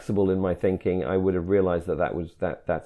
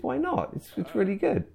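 incredulous; 11 semitones higher, 7 dB quieter than usual. Real, not acted. Disbelief at rival's dislike.

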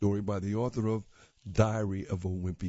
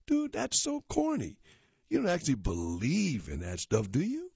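mocking teen cousin; 8 semitones higher than usual. A narrator's reading.

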